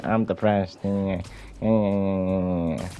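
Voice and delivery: drawn-out whiny imitation; mock whining. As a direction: wail it like a hammed-up complainer. Mocking people complaining of depression.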